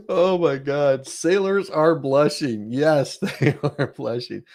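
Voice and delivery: slow, giggling through the words; chuckling, drawn-out amusement; laughing. Real, not acted. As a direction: laugh it out, amused and a bit scandalised. Amused by the mechanic's swearing.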